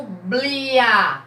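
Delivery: "blia" drawn out as one unit; joined syllable. Shown as a demonstration. Teacher showing what stays together, sounded-out syllable. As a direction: sound out "blia" on its own, held. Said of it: "que está junto".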